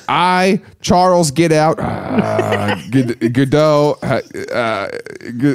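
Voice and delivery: halting, fragmented self-introduction; mock nervous stammer. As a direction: stumble over your own name in nerves. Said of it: "he's nervous".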